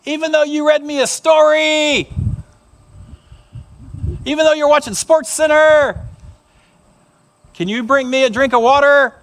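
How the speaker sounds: put-on whiny kid calling out to dad; little boy calling Dad. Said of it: "the boy said".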